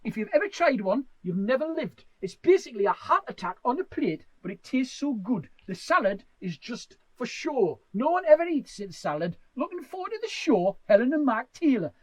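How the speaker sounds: put-on reading a fan message in a Middlesbrough accent. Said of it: "I'm gonna read it in Middlesbrough accent".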